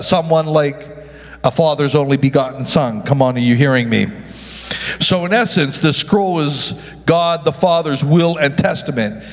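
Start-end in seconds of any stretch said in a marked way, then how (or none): none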